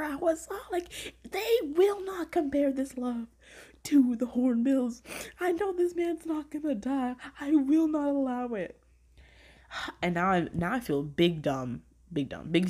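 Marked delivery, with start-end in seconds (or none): 1.3-8.8 mock distraught protest. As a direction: refuse it in a melodramatic high whine. Re-enacting her distraught refusal.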